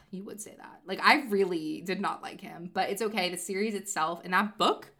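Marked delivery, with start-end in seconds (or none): 0.1-0.8 muttered aside. Retold or imitated. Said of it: "and I'm like".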